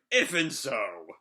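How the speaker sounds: loud, drawn-out character voice; abrupt phone greeting. Acted. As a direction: blurt it out loudly and stretch it, acting the character.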